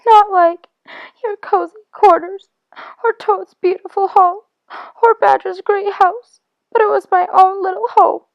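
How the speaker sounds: the homesick mole weeps and begs his friend; tearful pleading. Acted. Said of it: "he sobbed forth at last brokenly".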